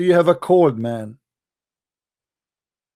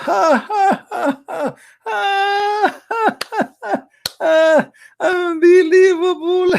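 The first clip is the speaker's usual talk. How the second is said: high, shrill-free mocking devil laughter, much higher; devil's cackle; 3 dB louder, much slower than usual. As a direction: cackle like a gleeful devil, stretching the last word slowly. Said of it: "Shaitan, he laugh".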